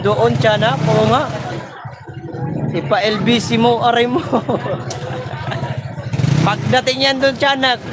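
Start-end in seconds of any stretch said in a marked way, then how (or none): 4.2-6.4 breathy laugh. Real, not acted. Laughing during livestream chat.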